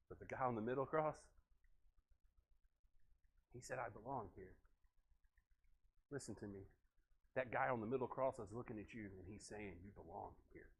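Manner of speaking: soft invitation. Acted. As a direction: draw them in, almost confiding. Soft altar-call preaching.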